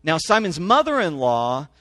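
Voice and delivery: rising, emphatic public reading of the passage; scripture reading. Acted. Pastor reading Mark to his congregation, animated scripture reading. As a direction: read the gospel out to the congregation with growing force.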